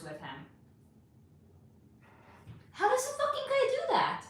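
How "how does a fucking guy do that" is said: much higher, incredulous question; exasperated disbelief. Real, not acted. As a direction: ask in high, stunned disbelief. Ranting about the date.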